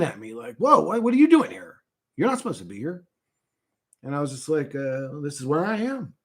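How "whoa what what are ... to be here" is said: high startled whoa, then trailing off; a touch of shock. Retold or imitated. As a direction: sound caught off guard by a stranger. Startled figure meets intruder.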